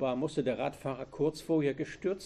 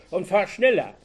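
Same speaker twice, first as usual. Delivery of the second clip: angry yell, higher and louder; screaming; 5 semitones higher, 10 dB louder than usual. A narrator's reading. Robber warning Anke after she waved, screaming. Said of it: "schrie er".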